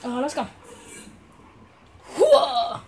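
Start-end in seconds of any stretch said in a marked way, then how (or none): 2.2-2.8 high, loud cry of surprise; surprised whoa. Real, not acted. Gamer yelps at something sudden on screen. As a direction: caught off guard.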